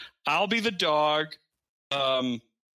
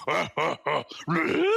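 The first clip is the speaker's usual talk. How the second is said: mock caveman grunts. Sitcom guy grunting on TV.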